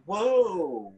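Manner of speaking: a hint of an alarmed mother; lightly voiced mother's shock; mother's surprised whoa. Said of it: "my mother was like".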